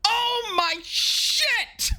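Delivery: very high, slow, drawn-out gasp; shocked shriek. Acted. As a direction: stretch it out in shrill disbelief. Exaggerated shocked reaction.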